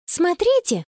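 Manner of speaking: put-on high, eager child's shout-out; excited call. A narrator's reading. Child spotting a shell on the beach, excited. Child calls others to look.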